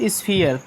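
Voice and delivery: "स्फीयर" as sfee-ar, falling; ee-a ending. Shown as a demonstration. Teacher presents the next word to learners, "स्फीयर" with ee-a glide. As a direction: model "स्फीयर" with ee gliding into a. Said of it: "ई से ई आ होगा".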